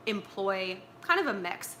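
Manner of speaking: ordinary delivery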